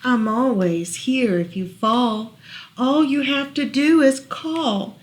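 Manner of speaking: gentle storybook read-aloud; reading to children. Acted. Parent reading a bedtime book to child, gentle read-aloud.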